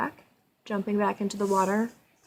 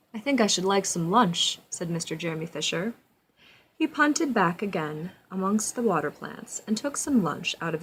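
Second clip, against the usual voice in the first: storybook narration; 2 semitones lower than usual. A narrator's reading. Reads storybook aloud to child.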